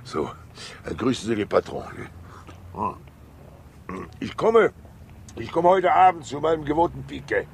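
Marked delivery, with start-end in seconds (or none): 4.2-7.5 loud, high call over his shoulder. Acted. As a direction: shout it back as he walks away. Calling out while leaving.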